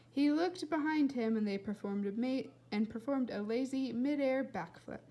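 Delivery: ordinary delivery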